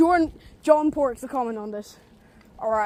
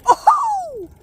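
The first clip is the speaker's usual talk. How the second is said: sharp high yelp of surprise; startled shout; 5 dB louder than usual. Real, not acted. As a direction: jolt of surprise, loud. Sudden skid on the bike.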